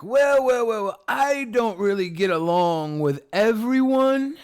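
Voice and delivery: mock someone pleading for their own little patch; mocking ethno-state advocate. Streamer parodies someone who can't get along with everyone, mocking. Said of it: "You're like".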